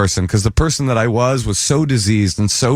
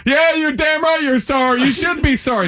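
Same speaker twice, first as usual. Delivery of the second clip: much higher, huffy and self-righteous; mock indignant scolding. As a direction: play it as a wounded know-it-all telling him off. Scolding friend who hit him.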